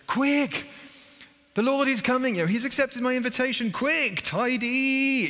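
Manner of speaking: high, loud, panicked hostess; a frantic sister hurrying to tidy; flustered Martha. Retold or imitated. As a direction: rush it like guests are at the door. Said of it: "Martha's first reaction is".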